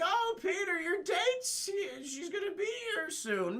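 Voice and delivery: high-pitched mock aunt voice; Aunt May. Aunt telling Peter his date's here, high put-on voice. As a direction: put on a falsetto doting aunt.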